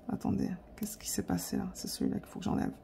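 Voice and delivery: whispering under her breath to herself; a hushed self-directed mumble; whispered, puzzled, to herself; whispered aside. Real, not acted. Whispering aside while editing.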